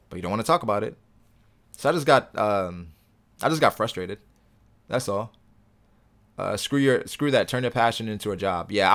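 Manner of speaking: usual speaking voice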